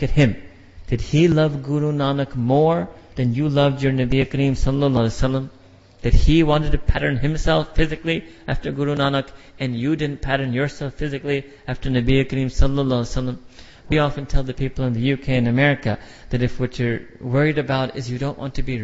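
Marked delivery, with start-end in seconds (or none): none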